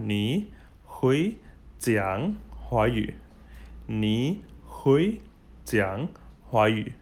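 slow, word by word, with gaps; slow model phrase. Shown as a demonstration. Teacher models a Mandarin sentence for beginners, slow and separated. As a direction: space out each word for learners to copy.

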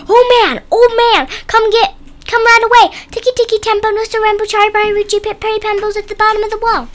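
high, loud call for help; urgent calling out. A narrator's reading. Chang calling the old man to the well, urgent high shout. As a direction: call out high, then rattle off the message.

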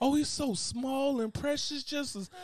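high, slow fake cooing over a baby. Visitor praising an ugly baby, high slow coo. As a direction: coo like a polite visitor hiding disgust. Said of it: "like somebody look at your baby".

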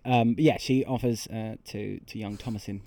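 ordinary delivery

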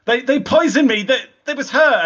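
high, loud stammering outburst; frantic accusation. Acted. Beren points out his poisoner to Radagast, frantic and high.

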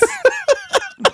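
high-pitched laughing squeal; high laughter. Real, not acted. Big laugh at own joke.

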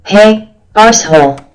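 loud abusive yelling; angry shout. Acted. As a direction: bellow insults down the phone. Caller yelling at the answering machine.

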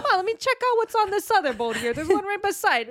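high, loud, quick playful impersonation; mock eager shopper. Co-host plays friend scouting the other boat, mock eager. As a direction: tease her by acting eager for the next boat.

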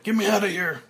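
high, loud frantic shout; berserk shout. Acted. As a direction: scream to get out. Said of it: "Frank goes berserk".

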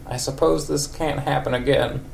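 put-on high wavering old lady voice. Old lady Marge answers Ned after their night. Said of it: "old lady for Marge".

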